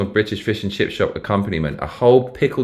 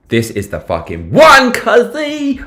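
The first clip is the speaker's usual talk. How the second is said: excited; 7 dB louder, slower than usual. Real, not acted. Excited about booking.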